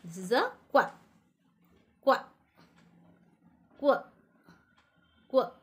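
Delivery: "qua" said as a clipped kw sound. Shown as a demonstration. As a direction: sound out "qua" as short kwa. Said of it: "see children".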